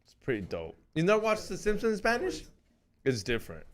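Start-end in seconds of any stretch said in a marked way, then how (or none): none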